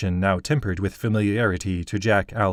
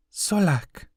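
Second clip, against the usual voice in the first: voiced high like a young girl, gentle; high girl's voice; 10 semitones higher than usual. Girl reassures the grieving craftsman, high and gentle. Okizi names the enemy.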